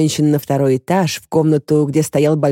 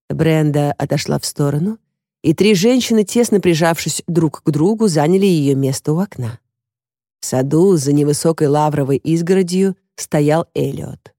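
ordinary delivery

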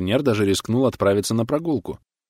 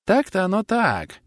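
high, slow rueful concession; sighing agreement; 11 semitones higher, slower than usual. A narrator's reading. Tablemate concedes the jibe, high and sighing. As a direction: draw it out with a sigh. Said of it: "вздохнул сосед по столику".

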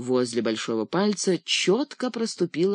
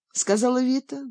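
ordinary delivery

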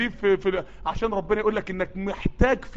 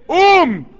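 high, loud قوم; rally the crowd to rise; crowd-rousing cry; shouted refrain; 7 semitones higher, 9 dB louder, much slower than usual. Acted.

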